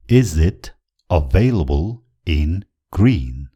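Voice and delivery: slow, broken into separate chunks with pauses; word-by-word model. Shown as a demonstration. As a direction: spell it out for learners, one piece at a time. Teacher models phrase word by word. (शब्द-शब्द छुट्याएर बिस्तारै)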